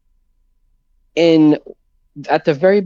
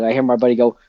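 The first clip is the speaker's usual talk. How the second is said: ordinary talk, nothing marked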